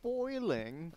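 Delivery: tentative Australian imitation; Australian accent attempt. Shown as a demonstration. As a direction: try copying an Australian accent, softly. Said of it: "I'm getting the Australian accent now".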